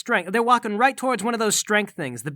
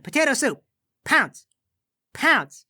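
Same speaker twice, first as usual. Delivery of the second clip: pet command; 5 semitones higher, slower than usual. Acted.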